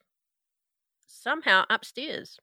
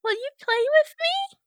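put-on creepy little girl=85; 6 dB louder than usual.

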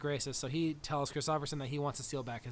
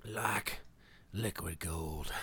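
low, drawn-out character imitation; slow, deep croak of a film villain; Rat; 9 semitones lower, 4 dB quieter, much slower than usual. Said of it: "William Dafoe is great as Rat".

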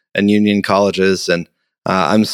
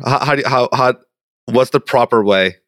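ordinary delivery

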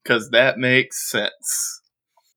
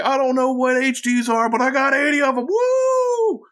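high squeaky gleeful goblin; excited goblin yell. Acted. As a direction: shout it out, giddy and high. Goblin skating past, excited shout.

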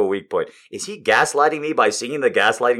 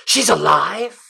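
quoting a movie line; 9 semitones higher, slower than usual. Retold or imitated. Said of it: "Steven Weber goes".

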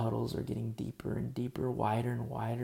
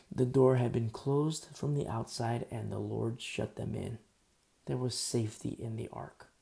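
ordinary delivery

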